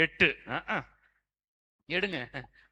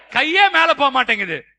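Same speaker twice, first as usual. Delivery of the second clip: high, loud complaint to the crowd; loud chiding; 7 semitones higher, 12 dB louder than usual. Real, not acted. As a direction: scold them loudly from the pulpit. Chiding congregation for not raising hands. (உரத்த கண்டிப்பு)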